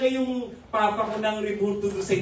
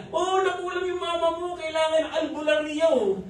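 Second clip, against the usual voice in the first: alarmed neighbors; 9 semitones higher than usual. Retold or imitated. Neighbors urging a folk healer.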